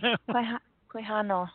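Quijano mit spanischem j als weiches h gesprochen, kwi-HA-no; Spanish accent. Shown as a demonstration. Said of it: "give it to me with your great Spanish accent".

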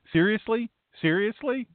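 high, questioning millennial incredulity; mock millennial. Pastor mimicking what millennials do now, incredulous. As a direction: imitate an incredulous millennial, pitched well up. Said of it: "the millennials, this is what they do now".

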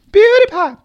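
squeaky high mock call of the name; mock youtuber intro. Host sarcastically echoing the YouTuber's intro, high squeaky call. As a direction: pitch the name way up like an intro jingle.

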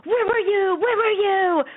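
high-pitched mock-hurt whine; acted plaintive complaint; wailed reproach. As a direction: cry it out at your absent friend. Acting beaten friend's complaint.

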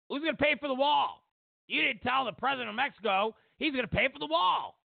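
high, indignant mock reporter; mock press grilling. Mocking the press grilling Trump, high and indignant. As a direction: mimic the press jeering at him.

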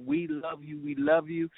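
ordinary talk, nothing marked

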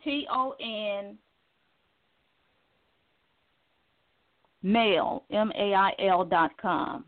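slow corrective spelling; spelling correction. Real, not acted.

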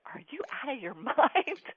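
incredulous, with a laugh mid-line; laughing disbelief. Retold or imitated. Seeing the scammer live.

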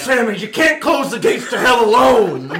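dramatic shout. Acted. Dramatic movie line.

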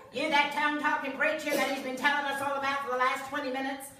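high, loud mock waitress voice; brash waitress. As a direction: holler it across the table like a brash waitress. Retold waitress accosting the preacher.

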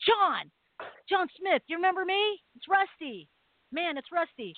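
calling out. Retold or imitated. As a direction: hail him like an old friend to defuse a knife fight.

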